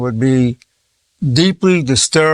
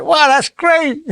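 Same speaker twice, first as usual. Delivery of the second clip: high, slow, drawn-out fake wow; lit-up mock delight; 12 semitones higher, much slower than usual. Congratulating a man on his loss, slow high mock delight. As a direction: beam absurdly at bad news. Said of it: "imagine if I lit up and said".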